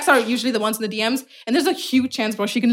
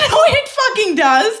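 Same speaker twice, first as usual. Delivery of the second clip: laughing protest; 8 semitones higher than usual. Real, not acted. Laughing protest after quote.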